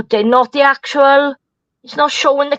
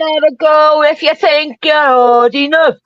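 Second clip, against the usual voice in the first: put-on belligerent drunk; 3 semitones higher, 3 dB louder than usual.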